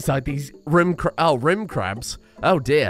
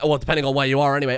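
usual speaking voice

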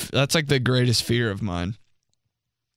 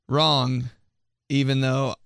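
ordinary talk, nothing marked

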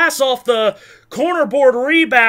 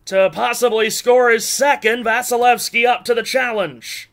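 usual speaking voice

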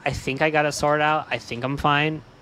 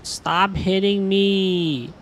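ordinary delivery

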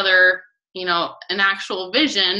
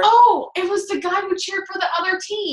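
excited remembering; 11 semitones higher, 3 dB louder than usual. Real, not acted. Suddenly remembering the story.

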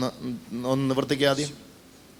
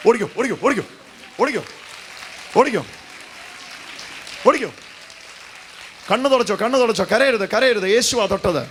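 excited urging; 8 semitones higher than usual. Real, not acted. Preacher cheers the healed man to run, excited shouts. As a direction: shout him on to run and jump.

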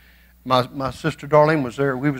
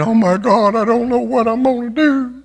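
mock fretful prayer; 9 semitones higher, 3 dB louder than usual. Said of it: "Don't you be going to God saying".

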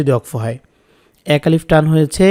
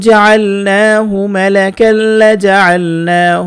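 Quran recitation; 3 semitones higher, much slower than usual. Shown as a demonstration. Teacher recites the verse for students to repeat. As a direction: chant the verse slowly, drawing out each vowel. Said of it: "আমার সাথে সাথে বলার চেষ্টা করবেন।".

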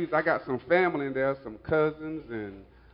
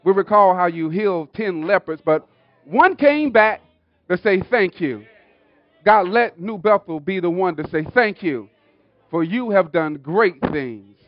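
raised, projected pulpit prayer building in intensity; fervent public prayer; 3 semitones higher, 9 dB louder than usual. Real, not acted. Pastor thanking God before the congregation, fervent prayer. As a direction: call out to God in rising phrases.

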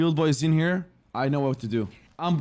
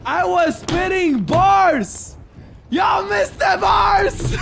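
loud, slow, stretched-out lament; anguished shouting; 8 semitones higher, 4 dB louder, slower than usual. Real, not acted. Streamer whose bars went unheard, anguished shouting. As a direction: wail each phrase slowly, rising higher. Lamenting that viewers missed rap.

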